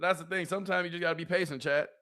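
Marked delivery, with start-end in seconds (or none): none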